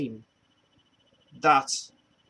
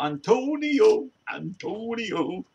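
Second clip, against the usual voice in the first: mimicked song refrain; 7 semitones higher, 4 dB louder, much slower than usual. Mimicking the Angelo song's refrain, high and drawn out. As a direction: stretch the name out high, then ease down.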